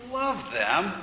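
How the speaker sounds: disbelieving question, high then dipping and rising; incredulous echo. Acted. Man questioning God's command to love, incredulous. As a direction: throw it back as a question.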